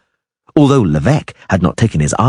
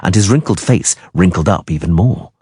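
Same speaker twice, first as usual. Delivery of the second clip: ordinary delivery, nothing marked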